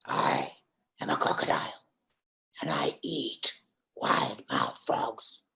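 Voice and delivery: low, slow, creaky crocodile voice; drawn-out, hammed-up crocodile threat; menacing crocodile. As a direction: growl it out as a ferocious predator. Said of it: "And the creature says".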